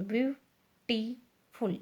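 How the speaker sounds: "Beautiful" in three slow, separated syllables. Shown as a demonstration. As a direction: break "beautiful" into byu, tee, ful with gaps. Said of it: "तीन है इसके अंदर syllable".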